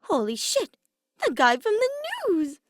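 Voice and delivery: high, loud excited shout; excited yell. A narrator's reading. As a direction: blurt it out, thrilled and loud. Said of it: "disrupting the small peaceful moment to yell out".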